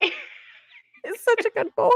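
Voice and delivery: very high, slow squeal of adoration; gushing coo. Real, not acted. Gushing over the dog in the book, high adoring coo.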